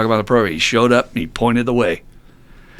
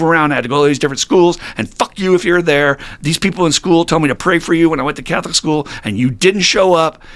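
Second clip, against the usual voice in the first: retold angry rant, building higher and louder; re-enacted venting at a higher power, climbing in pitch; laying it out; 5 semitones higher, 3 dB louder than usual. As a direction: pour out the grievances without letting up. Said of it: "I just started laying it out".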